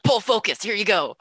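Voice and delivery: high, hushed urgent command; urgent director. Retold or imitated. Directing girlfriend on shoot, hushed.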